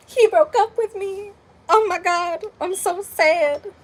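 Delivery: high, slow hammed-up wail of heartbreak; dramatic mock sadness. Fifteen-year-old calling her best friend, hammed-up sad. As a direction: overplay the teenage heartbreak. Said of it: "being dramatic because I was a dramatic person".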